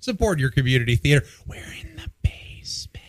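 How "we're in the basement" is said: eerie stage whisper. Acted. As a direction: breathe it out like a secret. Creepy whispered aside.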